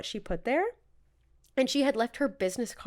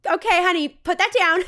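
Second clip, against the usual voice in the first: mocking boho mom; 7 semitones higher, 9 dB louder than usual. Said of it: "she's being so".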